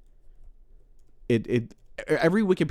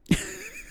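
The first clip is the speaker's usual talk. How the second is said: high laugh; 5 dB quieter than usual. Real, not acted. Cracking up at the bit.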